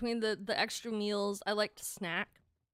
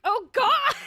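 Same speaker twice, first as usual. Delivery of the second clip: shrieking laugh, much higher and louder; laughing exclamation; 13 semitones higher, 15 dB louder than usual. Retold or imitated. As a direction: burst out laughing in shocked realisation. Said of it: "I was like".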